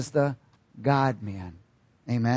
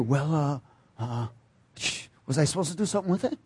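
softer, fast, fumbling excuse; sheepish sinner; 5 dB quieter, faster than usual. Retold or imitated. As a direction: stammer like someone caught unprepared. Sinner answering God at judgment.

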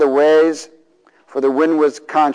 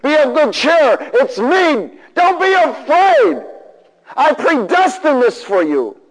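high, loud, slowed proclamation; fervent, high-pitched divine reassurance; the Father speaking; 12 semitones higher, 4 dB louder, slower than usual. Retold or imitated. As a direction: preach it as God reassuring his child. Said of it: "Your Father's telling you this".

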